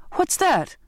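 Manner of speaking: high, startled question; sharp rise in pitch, startled; alarmed cry. A narrator's reading. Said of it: "I cried".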